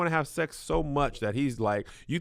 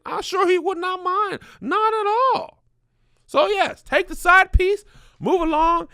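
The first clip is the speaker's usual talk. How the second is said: mock enthusiastic urging; 7 dB louder, slower than usual. Mock advising letter writer.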